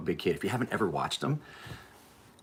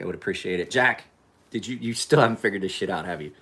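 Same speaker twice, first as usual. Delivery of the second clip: ordinary talk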